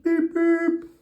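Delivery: loud, high imitated horn; high-pitched, blaring beeps; car horn beep. A narrator's reading. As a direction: honk it like a Jeep horn. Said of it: "he yells with a beep".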